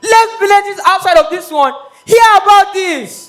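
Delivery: loud proclamation. Acted. Chief proclaims the coronation to everyone, loud. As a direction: call it out high and loud.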